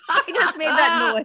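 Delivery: ordinary talk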